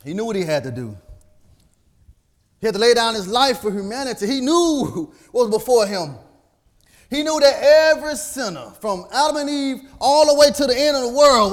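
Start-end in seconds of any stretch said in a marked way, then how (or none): 2.6-11.5 loud, high, rising preacher's cry; preaching at full force. Acted. Preacher stirring the congregation, full-force preaching. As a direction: build the fire, louder each line.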